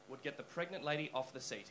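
ordinary talk

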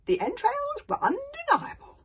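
put-on high, loud old grandfather voice; high-pitched insistence; emphatic old soothsayer. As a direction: declare it with great certainty.

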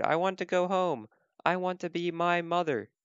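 acted homesick storybook voice; plaintive baby bird. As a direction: wail lightly for home and mother. Lost baby bird wants home.

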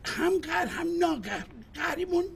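flustered husband denying a fight; agitated outburst. Acted. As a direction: blurt it out, caught off guard. Agitated over being sulky.

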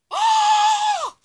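high loud shriek; sudden scream. Acted. Customer shrieking as his eyes change, high and loud. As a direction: shriek in shock.

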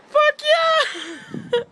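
high loud triumphant whoop; shrieking jackpot winner. Real, not acted. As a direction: cheer like you won. Recording still on, relief.

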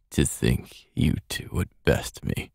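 low, soft, slow and weak. Acted. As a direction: speak as if fading. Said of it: "his voice weak".

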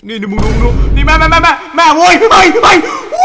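loud, high scared yelling; panicked shouting. Real, not acted. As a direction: yell in terror at the screen. Horror jumpscare. (ตะโกนตกใจสุดเสียง)

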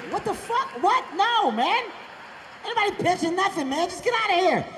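high, loud outraged yell. Retold or imitated. Comic shouting at the fan outside the stall, outraged. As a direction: shriek it in disbelief and shoo him away.

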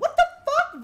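loud high outburst; shocked shout. Real, not acted. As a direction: yell it in disbelief. Sudden shock at game.

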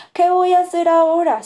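worried. A narrator's reading. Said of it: "se pregunta preocupado".

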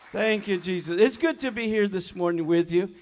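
ordinary delivery

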